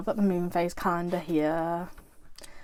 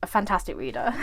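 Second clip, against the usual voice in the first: ordinary talk, nothing marked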